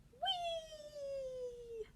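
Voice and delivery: high squeaky wee; teacher squealing wee for kids. Shown as a demonstration. Demonstrating the wee sound.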